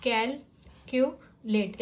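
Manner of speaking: Calculate slowly as cal, cu, late; divided into syllables. Shown as a demonstration. Teacher dividing the word, calculate in three separate chunks. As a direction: pause between the three sounds of calculate. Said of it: "you can divide".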